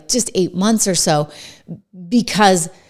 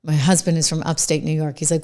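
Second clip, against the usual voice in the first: normal talking voice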